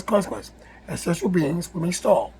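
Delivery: usual speaking voice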